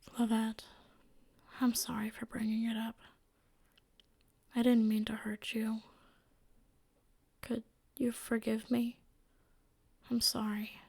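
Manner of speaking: whispered, gentle apology; soft apology. Acted. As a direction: say sorry softly, almost whispering. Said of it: "Monix softly called to her".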